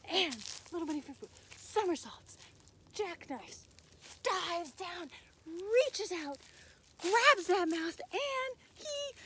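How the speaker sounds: high narration rising to loud; building excitement. A narrator's reading. Storyteller telling the bunny's dive at the mouse, mounting excitement.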